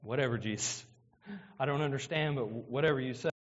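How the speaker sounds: low, offhand mock reply; a lazy, unimpressed "whatever"; dismissive shrug. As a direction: brush it off like a sulky crowd. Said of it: "And they go".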